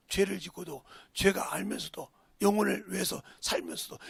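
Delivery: normal talking voice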